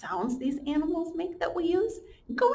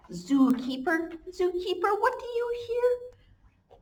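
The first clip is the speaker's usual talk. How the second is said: sing-song slow storybook read; reading to children; 7 semitones higher, 5 dB louder, slower than usual. Acted. Teacher reads the refrain aloud to her class, slow and sing-song. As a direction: chant the refrain for little ones.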